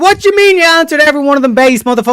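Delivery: high, fast disbelieving shout; incredulous yell. Real, not acted. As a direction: blow up in disbelief. Incredulous outburst at guest.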